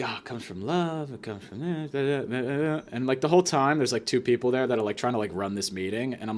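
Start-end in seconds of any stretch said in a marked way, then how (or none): 0.0-2.8 softer, mocking sugary churchy tone; mocking pious voice. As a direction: parody her gentle preachiness. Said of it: "She's like".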